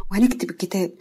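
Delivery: plain reading voice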